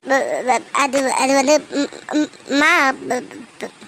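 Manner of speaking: broken, halting, too choked up to get words out; stammering through tears. Acted. Daughter too upset to speak.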